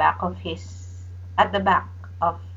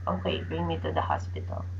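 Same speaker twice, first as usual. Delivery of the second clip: much lower, much softer and quick, a faint giving-in; a sick man finally agrees; weak husband voice; 10 dB quieter, faster than usual. Retold or imitated.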